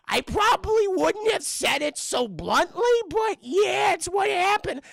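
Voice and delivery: very high, loud, strained character voice; hammed-up shrieky little guy; squeaky Whitey voice. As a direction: squeal it out in a cartoonish pitch. Whitey comments on the blunt news.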